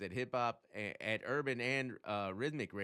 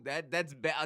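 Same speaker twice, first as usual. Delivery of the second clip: ordinary delivery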